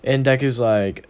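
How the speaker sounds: usual speaking voice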